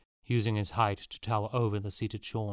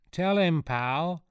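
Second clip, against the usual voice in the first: higher and louder, egging him on; eager chorus; 8 semitones higher, 5 dB louder, slower than usual. A narrator's reading. Cheering him on. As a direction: boys urging Pal to show off, loud chorus. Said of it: "chorused the two other boys".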